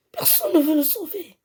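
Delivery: high-pitched distressed wail; desperate outcry. Real, not acted. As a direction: cry out that help is not coming. Nobody rescues the friend. (cri de désespoir aigu)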